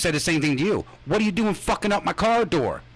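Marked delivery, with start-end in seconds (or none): 1.1-2.8 pitched-up mock scold, mildly heated; angry retort. Retelling the driver's irate complaint at him, a mild mock scold. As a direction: bark it like an irritated driver.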